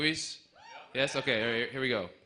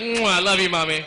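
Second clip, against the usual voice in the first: mock kiss to mom; 5 dB louder than usual.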